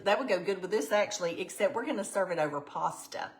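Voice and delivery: usual speaking voice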